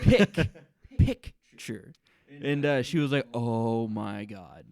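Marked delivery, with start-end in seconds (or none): 0.0-1.9 picture split as PICK, pause, chur; over-enunciated picture. Shown as a demonstration. Spells out the sound mom drops. 3.3-4.7 drawn-out Oh my God; very slow, exasperated dawning; acted slow realization. Acts out partner's slow realization.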